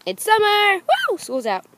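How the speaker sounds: loud high whoop; excited cheer. Real, not acted. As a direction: shout for joy, summer's here. Celebrating school's out.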